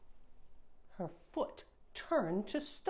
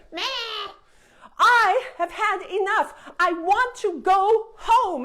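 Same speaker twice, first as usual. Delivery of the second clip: loud, higher furious princess; tantrum; 9 semitones higher, 10 dB louder than usual. Acted. Princess fed up and shouting to go home. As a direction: stamp and shout like a spoiled child.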